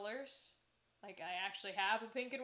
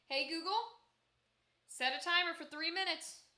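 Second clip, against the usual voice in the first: loud, clear command to smart speaker; talking to voice assistant; 5 semitones higher, 9 dB louder than usual. Real, not acted. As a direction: call out to the speaker.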